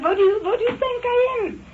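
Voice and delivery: high-pitched laughing through the words, close to tears. Real, not acted. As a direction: laugh helplessly as you tell it, voice jumping up. Laughs while retelling police threat.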